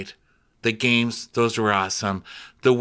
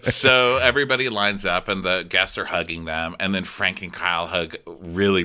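usual speaking voice

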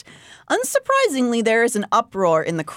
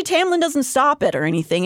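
usual speaking voice

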